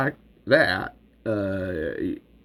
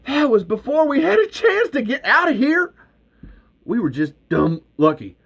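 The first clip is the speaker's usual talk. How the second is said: high, loud eager push; excited pleading; 12 semitones higher, 3 dB louder than usual. Acted. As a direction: sell the dream to Earl. Val pushing Earl, elated.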